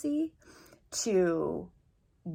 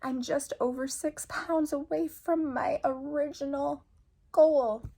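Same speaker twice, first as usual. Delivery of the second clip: high, choked-up voice fighting back tears; getting emotional; 7 semitones higher, 3 dB louder than usual. Real, not acted. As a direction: near tears, voice climbing high, one laugh breaking through. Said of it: "I'm sorry, I'm so emotional".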